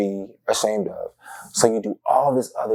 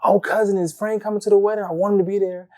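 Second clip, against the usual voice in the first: high, pleading relative voice, much higher; 11 semitones higher than usual. Retold or imitated. Family begging cousin to bring Frank, high pleading voice. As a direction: beg sweetly like an eager aunt.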